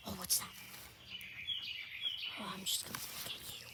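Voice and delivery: quick hushed whisper; fast whisper. Real, not acted. Whispering to animal playfully.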